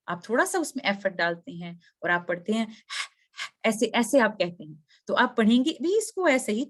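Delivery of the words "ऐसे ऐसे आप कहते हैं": normal talking voice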